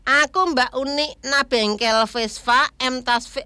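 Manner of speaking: usual speaking voice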